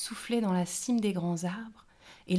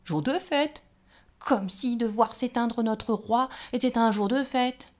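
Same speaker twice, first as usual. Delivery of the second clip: higher and louder indignant grumble; grumbling; 5 semitones higher, 7 dB louder than usual. A narrator's reading. Rose grumbles at the gnome while opening the door, indignant. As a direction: scoff at the idea of a feast. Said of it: "en ronchonnant".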